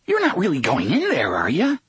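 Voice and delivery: quick, high panicky voice; panic-stricken. A narrator's reading. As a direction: rush it out in panic. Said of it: "a panic-stricken voice in his head asked".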